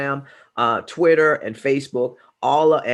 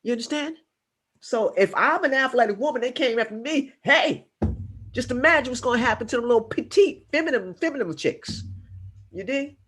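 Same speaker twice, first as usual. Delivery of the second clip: impassioned; 6 semitones higher than usual. Real, not acted. Impassioned warning to women.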